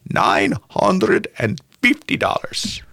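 put-on slow breathy whisper in a Russian accent. Old Russian buyer whispers his counteroffer in a Russian accent. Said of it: "Your, your Russian American accent was on point".

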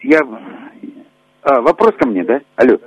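phone line delay. Real, not acted. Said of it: "немножко задержка связи".

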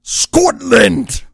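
Scottish accent on Scotland, with gusto. Shown as a demonstration. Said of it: "Say Scotland in a Scottish accent".

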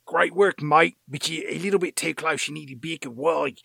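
mock Australian accent. Actor on walkie-talkie to the crew, mock Australian accent. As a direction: imitate a put-on Aussie character. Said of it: "he's not this Australian character".

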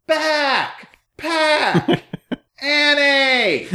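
high mock yell with long pauses between words. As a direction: call each word separately, as if to someone who can't hear. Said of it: "even louder, pronouncing the words individually".

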